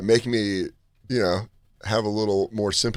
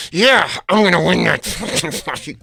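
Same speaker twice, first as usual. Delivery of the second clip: slow line through a clenched jaw; through gritted teeth; 6 semitones higher, 3 dB louder, slower than usual. Shown as a demonstration. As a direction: tough guy talking through teeth. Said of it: "the line through my teeth".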